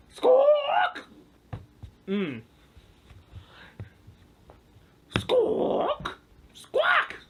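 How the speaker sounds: high squawking parrot imitation; mock parrot cry.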